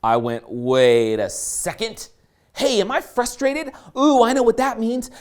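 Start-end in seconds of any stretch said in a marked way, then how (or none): 0.5-2.1 low, very drawn-out halt; slow dawning realization. Retold or imitated. Speaker catching own frustration, slow low realization. 2.5-5.1 mock delighted discovery. Acted delighted discovery of frustration.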